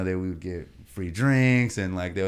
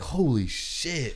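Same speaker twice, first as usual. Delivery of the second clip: stunned; 6 semitones higher, much slower than usual. Retold or imitated. Waking to a viral video.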